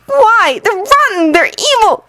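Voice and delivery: high, tearful protest; a boy crying out in anger; tearful outburst. Acted. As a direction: Anakin protesting through tears.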